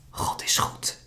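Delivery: faint, trembling whisper; a frightened mother whispering her faith; barely audible. Acted. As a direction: breathe the words in fear. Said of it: "zegt moeder nog nauwelijks hoorbaar".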